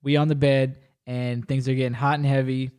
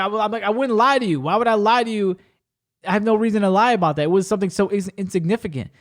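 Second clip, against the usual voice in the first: higher-pitched, insistent retold protest; indignant protest; 5 semitones higher, 4 dB louder than usual. Man denying he lied to her, indignant. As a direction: plead your case, pitched up and pressing.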